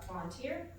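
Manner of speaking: usual speaking voice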